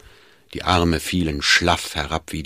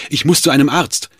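raised, louder desperate plea; urgent call; 8 semitones higher, 5 dB louder, faster than usual. A narrator's reading. Schmidt begs the Duke for a lift, calling urgently. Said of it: "rief er dem Herzog zu".

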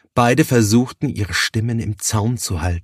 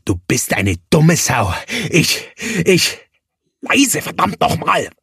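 higher, rising insult; swelling anger; 7 semitones higher than usual. A narrator's reading. Thaddäus insulting Johannes, voice swelling in anger. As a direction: let the anger grow as he curses. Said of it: "Thaddäus' Stimme schwoll an".

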